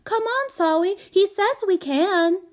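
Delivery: put-on very high, excited child's call; delighted little girl. A narrator's reading. Small child calling Solly aboard, delighted. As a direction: squeal it with glee, high up. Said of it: "jumping up and down and clapping".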